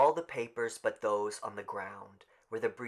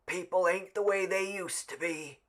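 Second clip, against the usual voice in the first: high, carrying complaint; a grumbling old man, lightly voiced; old man, said clearly; 9 semitones higher, 4 dB louder than usual. A narrator's reading. Old Man Warner to the crowd, clear and grumbling. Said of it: "said clearly".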